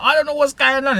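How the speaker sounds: high mock-girl bewilderment; confused girl. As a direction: squeak out the confusion of a scared girl. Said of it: "He's like".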